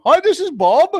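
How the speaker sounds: high-pitched fake Pakistani-accented agent; mock Pakistani accent. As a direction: imitate a Pakistani agent fibbing. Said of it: "You're in Pakistan".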